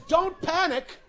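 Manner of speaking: high-pitched mock captain announcement; mimicked captain. Captain addressing worried passengers, high mock-announcer voice.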